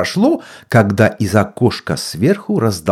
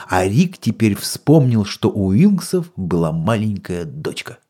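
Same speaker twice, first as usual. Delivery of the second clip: usual speaking voice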